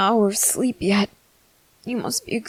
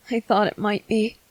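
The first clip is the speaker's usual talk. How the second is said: normal talking voice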